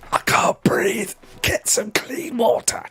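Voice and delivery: a whispered, gasping voice rising high; gasping fish. Retold or imitated. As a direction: play a fish choking in dirty water. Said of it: "him going".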